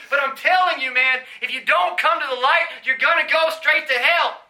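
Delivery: loud, high, shouted sermon warning; full-force preaching. Acted. Preacher warning the crowd they will go to hell, shouting. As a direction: shout the warning straight at them.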